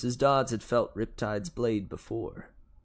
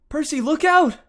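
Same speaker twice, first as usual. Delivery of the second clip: high, loud shout of alarm; urgent warning cry; 11 dB louder than usual. A narrator's reading. Annabeth warning Percy of the whip, urgent cry. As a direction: scream a warning to your friend. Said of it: "Annabeth cried".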